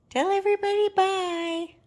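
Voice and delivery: high sing-song pet voice. Real, not acted. Owner coaxing her dog to say bye, high baby talk. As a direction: coax the puppy to wave goodbye.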